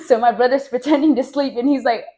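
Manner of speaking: breaking into real laughter mid-story; cracking up at the memory; laughing. Real, not acted. Laughs while recalling the scene.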